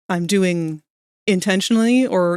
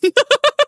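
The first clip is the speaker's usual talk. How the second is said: high squeal of laughter; 5 dB louder than usual. Real, not acted. Laughing about scared dog.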